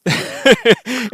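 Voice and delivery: loud burst of laughter; big laugh. Real, not acted. Laughing at own joke.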